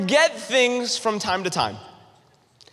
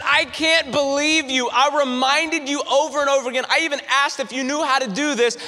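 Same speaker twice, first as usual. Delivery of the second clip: mock exasperated wife; 6 semitones higher than usual. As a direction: play the fed-up wife, pitched up.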